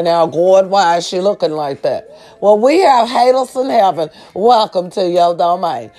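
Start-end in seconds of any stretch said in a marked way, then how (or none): none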